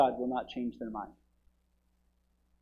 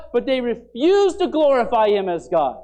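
forceful preaching; 8 semitones higher, 10 dB louder than usual. Acted. Loud sermon line then quiet echo.

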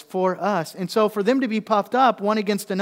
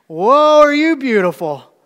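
loud, high mock praise; over-the-top sarcastic compliment; 7 semitones higher, 12 dB louder than usual. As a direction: gush sarcastically at the wife. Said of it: "That's sarcasm".

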